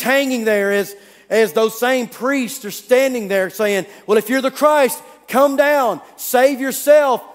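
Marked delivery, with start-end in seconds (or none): 4.1-7.2 mocking taunt. Priests jeering at the man on the cross, loud and sneering. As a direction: jeer up at him, drawing out the end.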